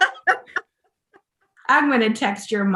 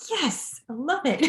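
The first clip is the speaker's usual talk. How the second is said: higher, swooping gush with a laugh; delighted reaction; 5 semitones higher, 4 dB quieter than usual. Retold or imitated. Listener thrilled by the narrator's accent, gushing. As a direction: savor it, breaking into a laugh.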